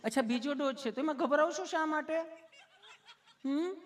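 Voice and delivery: high put-on nurse voice; sweetly asking nurse. Nurse asking why he is nervous, high mock sweetness. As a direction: coo questions up high at the patient. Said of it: "તમે કેટલું પ્રેમથી પૂછો છો".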